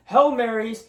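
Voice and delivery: normal talking voice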